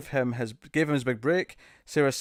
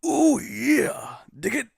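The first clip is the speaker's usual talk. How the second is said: Macho Man; 10 semitones higher, slower than usual. Retold or imitated. Streamer does Macho Man for chat, hammed-up promo voice. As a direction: ham it up like a wrestling promo. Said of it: "Could do a little bit of Macho Man".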